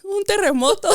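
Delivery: high squeal, laughing. Real, not acted. Laughing joke in Spanish.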